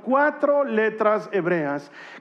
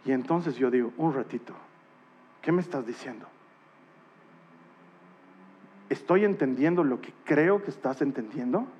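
awed hush; 8 semitones lower, 7 dB quieter than usual. Real, not acted. Preacher recounting his own revelation, hushed and awed. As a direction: whisper it in wonder.

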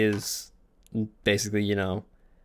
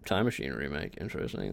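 much lower and softer, mumbled; trailing off; 10 semitones lower, 9 dB quieter, faster than usual. Real, not acted. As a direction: let it fade almost to nothing.

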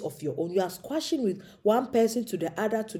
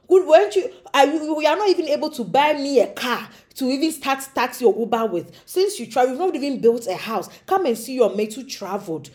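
nagging relatives; 3 semitones higher, 5 dB louder than usual. Retold or imitated. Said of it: "these people are still calling you".

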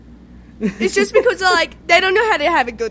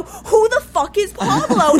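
high, slow acted exasperation; exasperated bafflement; 7 semitones higher, 4 dB louder, slower than usual. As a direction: demand it in mock disbelief, drawn out. Acted exasperated question in story.

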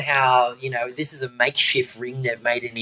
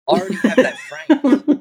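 laughing gloat; 12 semitones higher, 3 dB louder than usual. Retold or imitated. Mate gloating over taken medal.

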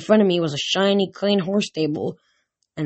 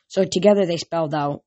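ordinary delivery

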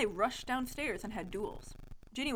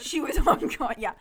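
laughing, much louder; 5 semitones higher, 10 dB louder than usual. Real, not acted. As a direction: crack up mid-sentence. Breaks up laughing reading.

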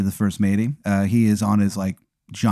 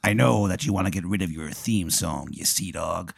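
soft pirate voice, dropping lower; pirate tomato; 3 semitones lower, 8 dB quieter than usual. Acted. Pirate tomato bargains with Aaron, low and soft. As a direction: play a hushed sea-dog pirate. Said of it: "he's a pirate".

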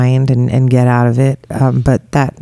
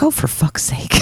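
exasperated mutter; 3 semitones higher, 7 dB quieter than usual. Retold or imitated. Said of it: "and then I got mad".

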